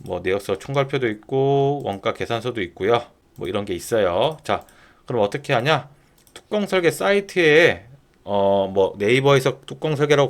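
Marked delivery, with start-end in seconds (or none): none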